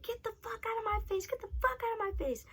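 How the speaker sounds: very high mock yell, held back in volume; squeaky retold tirade; mock going off. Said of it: "I'm just sitting there going off".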